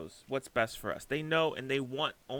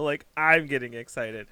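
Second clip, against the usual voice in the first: louder, still fired up; excited; 8 dB louder than usual. Real, not acted. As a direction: keep the excitement going.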